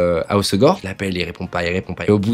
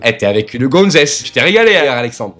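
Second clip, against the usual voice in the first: teasing father; 4 dB louder than usual. Retold or imitated. Dad razzing his son about a girl, loudly teasing. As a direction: crow it like an amused, nosy dad.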